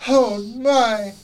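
high, loud fake gasp; mock shock. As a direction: gasp like a dramatic neighbor. Mocking Julie's reaction.

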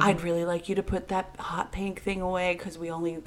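soft, syrupy gentle-parent voice; mocking an ultra-gentle mother; mock gentle tones. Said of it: "We only speak in gentle tones".

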